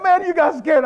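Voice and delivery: very high, loud tease; comic high-pitched exclamation; retold amused teasing. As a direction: imitate an amused onlooker, loudly. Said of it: "the guy there was laughing so bad".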